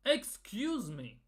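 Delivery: slow, drawn-out, falling exclamation; indignant disbelief. Real, not acted. Fan answering the Norway boss's joke, indignant and deliberate. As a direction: stretch it out as an affronted rebuke.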